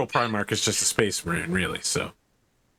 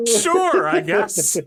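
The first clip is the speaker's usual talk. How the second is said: baffled; 10 semitones higher, 5 dB louder, much slower than usual. Real, not acted. Baffled reaction to toga remark.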